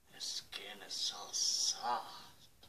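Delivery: slow, creepy whisper. Acted. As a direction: breathe it slowly and creepily. Puppet whispers creepily to host.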